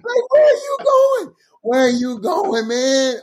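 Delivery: stretched, disbelieving where are you going; slow and high, like you can't believe it; incredulous. Retold or imitated. Asking a friend in disbelief, slow and high. Retold shocked reaction, slow.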